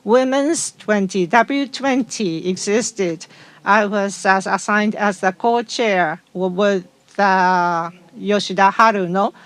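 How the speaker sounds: ordinary talk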